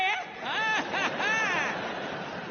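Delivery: high-pitched phony laughter; hammed-up cover-up laugh; forced fake laugh. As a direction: laugh too brightly to hide anger. Dad fakes cheer for toddler.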